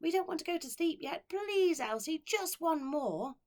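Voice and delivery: put-on high, loud begging child voices; pleading children. A narrator's reading. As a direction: beg like tired little kids. Orphans beg for story.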